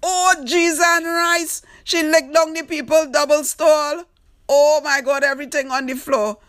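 high, loud disbelieving outcry; excited exclaiming. Real, not acted. Host reacting to Tanti wrecking the stall, loud and high. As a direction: yelp it out as the stall goes down.

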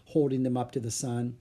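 plain reading voice

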